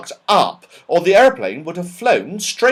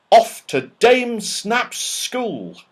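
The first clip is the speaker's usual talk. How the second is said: slow title reading; 8 semitones higher, much slower than usual. Acted. Reads the chapter title.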